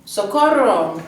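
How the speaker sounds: loud hammed-up shout for rescue; playful pretend plea, shouted; mock cry for help. Mock cry for help between sections.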